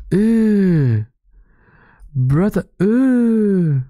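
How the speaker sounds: high, slow mock disgust; mimicked drawn-out "ew"; meme video impression. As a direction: stretch the "ew" high, then let it fall. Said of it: "The way he says it is so fucking funny".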